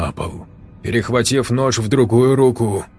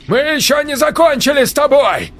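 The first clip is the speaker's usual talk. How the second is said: high loud shout; 3 dB louder than usual. A narrator's reading. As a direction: yell it back over the seat. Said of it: "выкрикивал Валерий Кротов". (крик)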